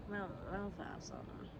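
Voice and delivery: very soft half-asleep mumble; barely awake, slurred and soft; sleepy voice. Retold or imitated. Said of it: "Like, sleepy as hell".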